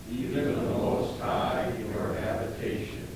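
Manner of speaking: a crowd of voices murmuring the response; many voices in unison. Real, not acted. Congregation answers responsive psalm.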